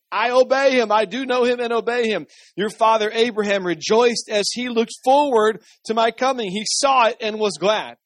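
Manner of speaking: high, forceful scripture reading; emphatic declaration. A narrator's reading. Jesus answering the religious leaders, emphatic declaration.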